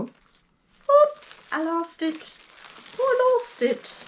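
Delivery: playful high squeal of dismay; high-pitched dismay. Real, not acted. Fumbling the little cards on camera, high playful dismay. Fumbles card while showing bag.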